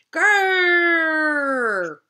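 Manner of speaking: big, high roaring growl; loud monster growl. A narrator's reading. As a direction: roar at the little brother.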